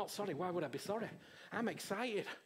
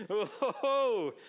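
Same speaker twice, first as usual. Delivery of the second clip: laughing; 7 dB louder, slower than usual. Real, not acted. Laughing at floating remark.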